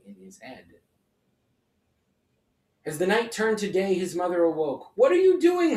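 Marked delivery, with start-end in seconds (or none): 5.0-5.8 much higher, louder sharp demand. A narrator's reading. Mother finding Herschel with the dough, demanding. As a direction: catch him in the act. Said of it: "she demanded".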